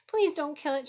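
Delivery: high, loud, pleading sob; sobbing plea. A narrator's reading. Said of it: "she sobbed".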